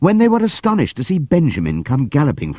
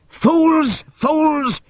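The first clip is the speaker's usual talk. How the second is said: high, angry falling yells; old donkey screaming at the crowd; furious shout; 3 dB louder than usual. Acted. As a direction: scold them hard. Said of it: "shouted Benjamin".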